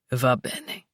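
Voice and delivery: low tender coax; low male voice. Acted. Luca voiced.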